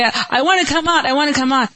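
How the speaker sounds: quick, high-pitched pleading; desperate plea. Acted. Preacher voicing addicts who want out, urgent plea.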